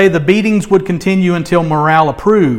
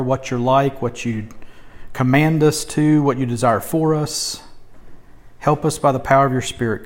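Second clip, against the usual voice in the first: prayer; 2 semitones lower, 3 dB quieter than usual. Real, not acted. Opening prayer before sermon.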